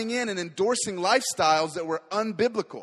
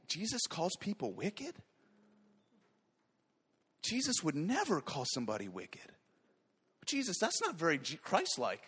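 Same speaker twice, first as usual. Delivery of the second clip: softer, feigned shock at the idea; sarcastic mock disbelief; 10 dB quieter than usual. Preacher mocking a soft view of Jesus, sarcastic and softer. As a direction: play the naive skeptic on purpose. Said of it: "flippantly and sarcastically on purpose".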